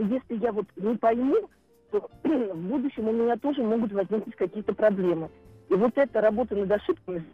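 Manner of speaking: ordinary talk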